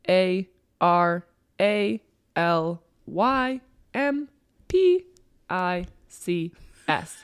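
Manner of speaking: letters spelled out slowly. Shown as a demonstration.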